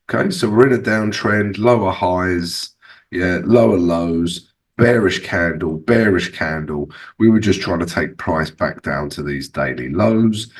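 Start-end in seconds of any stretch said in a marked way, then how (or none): none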